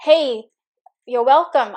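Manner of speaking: ordinary delivery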